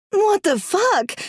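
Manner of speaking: very high, slow, stunned; shocked cry. Acted. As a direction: cry out in disgust. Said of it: "she cried".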